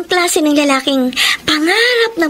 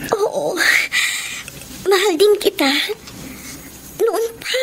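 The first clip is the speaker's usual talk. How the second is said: higher, soft voice with crying; tearful confession; 5 semitones higher, 5 dB quieter than usual. Acted. Rihanna confessing her love to Jimmy, crying softly. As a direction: let the tears break through the words.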